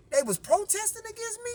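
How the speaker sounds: high, fast incredulous question; can't believe they turned on him; shocked disbelief. Retold or imitated. Brother shocked in dream.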